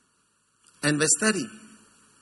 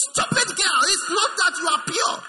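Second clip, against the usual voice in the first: high-pitched, louder mock scolding; scolding rebuke; 11 semitones higher, 4 dB louder than usual. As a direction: cut down the boaster, pitch jumping up. Mock rebuke of the boasting girl.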